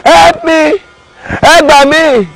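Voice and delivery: high mock cries for help from the hole; shouting for help. Tortoise trapped in a hole, crying out for help. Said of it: "He was now shouting".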